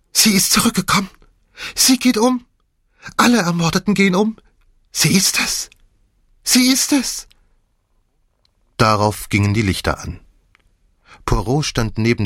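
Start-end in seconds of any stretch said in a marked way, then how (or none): 0.1-7.3 hysterical shrieking. A narrator's reading. Maid sure the dead walk again, hysterical shrieking. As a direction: scream the words, beside yourself with fear. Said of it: "kreischte sie".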